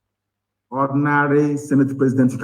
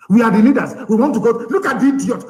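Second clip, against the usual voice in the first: mock them angrily in a raised pitch; heated mockery; 8 semitones higher than usual. Heated mocking of political leaders.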